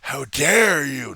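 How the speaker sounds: slow, loud preacher rant; slow, loud mock preacher tirade; practicing a speech. Shown as a demonstration. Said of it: "Notice the fist at this point".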